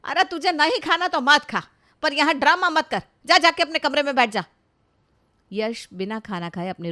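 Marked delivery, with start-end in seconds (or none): 0.0-4.4 loud, high, exasperated telling-off; angry scolding. Acted. Parent ordering son to his room, angry scolding. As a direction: send him away with a sharp scolding. Elder scolds him during quarrel.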